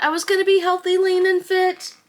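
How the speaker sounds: sing-song self-mockery; mock hopeful. Mocking her own past hopes.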